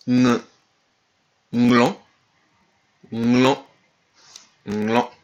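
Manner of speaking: "nglan" opening on N and G stuck together; joined NG. Shown as a demonstration. Teacher models the NG letter, "nglan" with fused onset. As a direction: hum a loud NG into "nglan", barely sounding the G. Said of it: "un N et un G collés".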